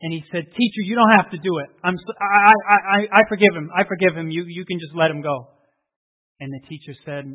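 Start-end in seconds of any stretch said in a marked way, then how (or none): 0.4-5.5 loud, desperate pleading, voice breaking; a boy begging loudly for his friend; pleading cry. Acted. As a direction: beg the teacher to stop.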